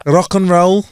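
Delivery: [name]. Acted. Co-host mimicking an [name] brother, Mancunian accent. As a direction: lightly mimic a Manchester rocker's accent. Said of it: "That's what one of the [name]'s always says".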